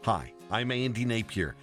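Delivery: ordinary talk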